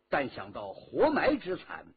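ordinary delivery, nothing marked

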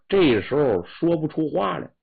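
plain reading voice

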